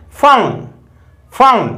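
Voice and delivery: फोन said as fone, long o glide; फोन with the o as in English phone; correct pronunciation fone. Shown as a demonstration. Teacher showing students how to say फोन properly. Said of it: "बोला इसको कैसे जाता है".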